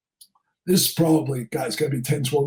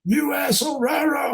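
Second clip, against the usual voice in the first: high mock yell, no real volume; retold scream; 6 semitones higher than usual. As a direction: imitate an irate father screaming orders. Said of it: "the father screamed".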